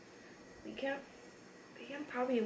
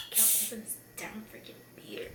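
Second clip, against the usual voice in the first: frustrated whisper under breath; 10 dB quieter than usual. Real, not acted. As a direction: mutter it softly to yourself. Struggling with a beer cap.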